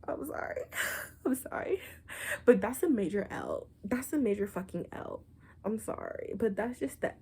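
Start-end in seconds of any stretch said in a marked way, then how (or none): 0.1-1.8 giggling apology. Real, not acted.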